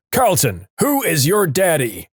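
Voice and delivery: retold drill sergeant yell. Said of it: "he yells".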